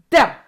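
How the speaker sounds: "Duh" with the tongue behind the teeth, pushed out hard; D sound demo. Shown as a demonstration. Teaching kids the D sound, "Duh" pushed hard. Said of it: "push that sound out real hard".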